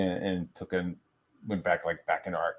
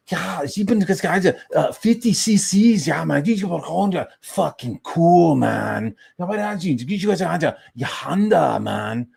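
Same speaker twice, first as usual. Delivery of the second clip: high, excited mock-German chatter; imitated German guy; 8 semitones higher, 5 dB louder, slower than usual. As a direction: mimic a German babbling to his friend, drawing out the English bits. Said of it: "this young guy next to us was talking to his friend".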